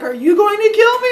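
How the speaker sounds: very high, loud squeal; mock terrified child. Boy asking policemen his fate, high loud panicked squeal. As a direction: falsetto panic, played for laughs.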